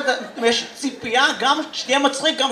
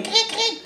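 high-pitched cricket chirps; imitated cricket; 11 semitones higher, 4 dB quieter than usual. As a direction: chirp twice like crickets in a silent room. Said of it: "שהם צרצרים". (חיקוי צרצרים בקול גבוה)

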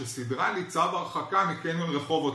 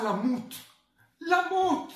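למות repeated, jumping much higher; stress on למות; 8 semitones higher, slower than usual. Retold or imitated. Scolding a boy about his mother, dramatic stress on למות. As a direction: echo למות as a high, incredulous punch.

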